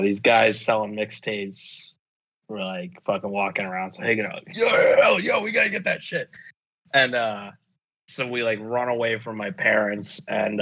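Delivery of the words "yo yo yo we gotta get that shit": high, eager hyped-up yo yo yo; pumped kids begging for the mixtape; high excited street greeting. Retold or imitated. Kids hyped about mixtapes, retold.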